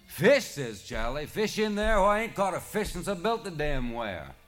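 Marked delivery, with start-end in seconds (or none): 0.1-0.4 high, loud disbelieving yelp; incredulous shout. A narrator's reading. Charlie can't believe the serpent's claim, loud outraged yelp. 1.3-4.3 put-on high, loud, indignant old fisherman; indignant Charlie. A narrator's reading. Charlie denies catching any fish, loud indignant protest.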